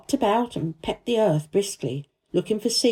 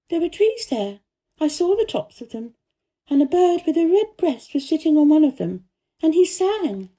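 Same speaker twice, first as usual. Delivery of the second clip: put-on lightly higher girl's voice, earnest; a girl telling the gardener about the secret garden, higher voiced; young Mary voice; 8 semitones higher, 3 dB louder than usual. A narrator's reading. Mary's voice in the story.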